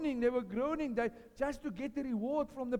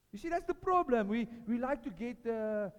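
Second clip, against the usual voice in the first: usual speaking voice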